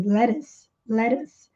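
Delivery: "lettuce" said with a flapped T, "leres"; let us like lettuce. Shown as a demonstration. Teacher shows "let us" sounds like "lettuce". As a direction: say "lettuce" fast with a soft flapped T. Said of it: "soa muito parecido com a alface".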